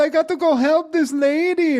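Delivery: put-on high, loud, hurried old lady voice; frantic old lady. Host voicing a possessed Doctor Ruth bolting after Annie, shrill and loud. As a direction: squawk it out like a spry old woman in a rush.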